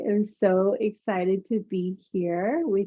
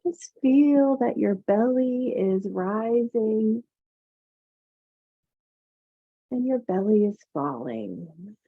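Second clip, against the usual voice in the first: soothing massage murmur. Acted. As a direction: ease them into calm breathing. Yoga teacher guides breathing meditation.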